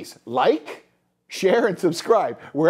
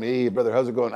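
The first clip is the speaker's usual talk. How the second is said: low-pitched casual bro voice; low, chummy parody; mock bro greeting; 9 semitones lower, faster than usual. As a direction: greet like a laid-back bro. Acts out bro small talk.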